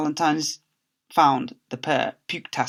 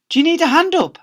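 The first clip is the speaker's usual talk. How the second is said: much higher, louder concerned question; Miss Dovey asking; 7 dB louder than usual. Acted. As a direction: fuss over him kindly. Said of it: "asked Miss Dovey".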